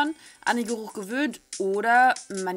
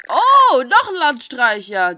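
surprised exclamation; 2 semitones higher, 11 dB louder than usual. Real, not acted. Reacts to a new character. (lauter, überraschter Ausruf)

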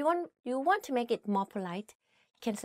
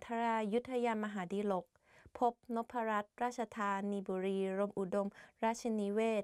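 rapid-fire recital; much faster than usual. Shown as a demonstration. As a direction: rattle off กรุงเทพมหานคร อมรรัตนโกสินทร์ in one fast breath. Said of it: "It's like I was rapping". (rapid recital like rapping)

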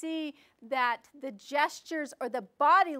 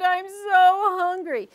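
drawn out, higher and louder; slow hungry whine; 6 semitones higher, 7 dB louder, slower than usual. Shown as a demonstration. Storyteller shows a hungry boy, slow and drawn out. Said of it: "the fast and slow".

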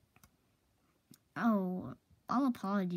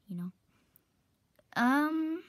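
normal talking voice

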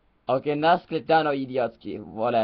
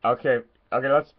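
usual speaking voice

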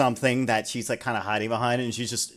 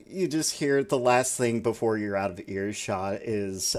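usual speaking voice

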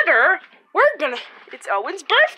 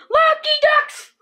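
high excited shout of the school name; 10 semitones higher than usual. Pretend. Teacher shouting the school name to the class. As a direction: yell it out like a cheering class. Kid playing preschool teacher.

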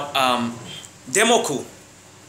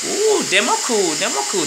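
high cooing ooh Demoku; admiring women; 6 semitones higher than usual. Retold or imitated. Village women walking past Demoku, high admiring coo. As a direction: coo his name like an admiring woman. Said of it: "village women ... are like".

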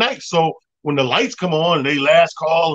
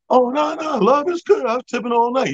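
mock pleading; 8 semitones higher than usual. [name] voiced pleading with bouncer.

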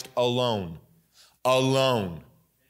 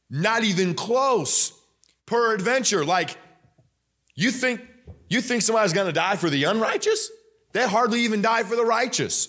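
much higher, fast, disbelieving challenge; preaching, incredulous; 9 semitones higher than usual. Acted. Preacher mocking the idea of dying for sinners, high and incredulous. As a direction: throw the question at them in disbelief.

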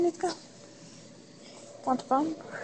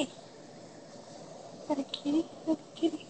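whisper; 9 dB quieter than usual. Real, not acted. Owner points cat at camera.

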